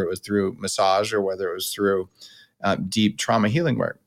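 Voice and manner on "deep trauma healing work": normal talking voice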